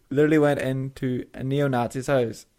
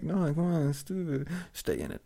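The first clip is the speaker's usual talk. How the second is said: soft mock plea; 9 dB quieter than usual. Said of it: "I'm like".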